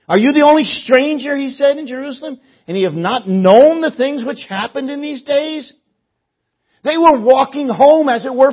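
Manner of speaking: loud, high, impassioned sermon delivery; preaching at full force. Acted. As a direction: drive each phrase home at full preaching pitch.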